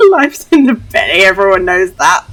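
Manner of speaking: put-on loud, silly accented character voice, breaking into laughter; odd put-on accent. Know-it-all kid arguing about a train's belly, loud silly accent. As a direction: belt it out in a daft accent, giggling. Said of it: "What accent is this? What voice is this?".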